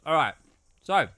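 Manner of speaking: usual speaking voice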